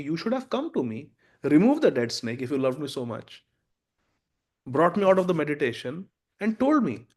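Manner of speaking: loud, emphatic reproach with pitch leaps; scolding father. Acted. Father to son who cursed the king, acted scolding. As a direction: lay into him, hammering key phrases.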